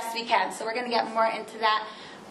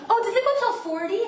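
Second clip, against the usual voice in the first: real surprise, pitch jumps way up; surprised; 10 semitones higher than usual. Real, not acted. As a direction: caught off guard, a sudden high question. Learns the class runs longer.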